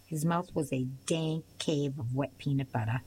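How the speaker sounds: ordinary delivery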